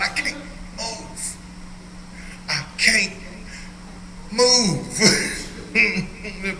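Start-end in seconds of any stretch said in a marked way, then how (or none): 0.0-5.0 mock stiff old man, slow and breathless; creaky old man. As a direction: imitate an arthritis-locked old body, dragging the words. Mocking arthritic old self.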